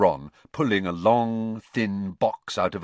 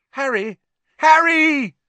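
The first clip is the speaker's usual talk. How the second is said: calling across the street, much higher and louder; yelling his name; 9 dB louder than usual. A narrator's reading. Friends spot Harry across the alley, excited yell. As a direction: shout it out to a friend far off. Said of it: "someone yelled his name".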